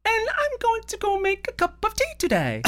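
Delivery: very high, badly faked British accent; bad female-sounding British accent. Co-host showing off for friends, bad high British accent. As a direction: squeak out a hammed-up British lady accent. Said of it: "Why did you also become female".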